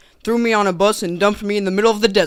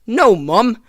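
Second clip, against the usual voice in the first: put-on British child correcting mum; mock-British complaint to mother; British accent; 2 semitones higher, 5 dB louder than usual. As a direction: protest as a British kid. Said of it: "pioneers had British accents in that scene".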